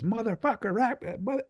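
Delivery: high squeaky mock yell of the guy; a guest mimicking a heckler's high outburst. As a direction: parody him losing it in a high voice. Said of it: "he started raising his voice".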